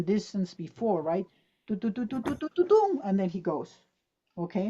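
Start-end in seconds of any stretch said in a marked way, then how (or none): 1.7-3.0 run-up rhythm. Shown as a demonstration. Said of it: "athlete run-up imitation".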